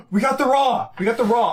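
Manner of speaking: loud, high, thrilled yelling; excited shouting. Real, not acted. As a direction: shout it out in pure joy. Big pull while opening packs.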